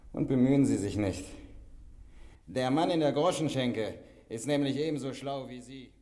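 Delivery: normal talking voice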